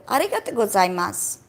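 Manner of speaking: Japanese pronunciation with tapped r; Japanese accent. Shown as a demonstration. Teacher showing pronunciation without local accent, Japanese accent. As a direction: say it as a Japanese speaker would. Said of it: "like how a J-, Japanese person says".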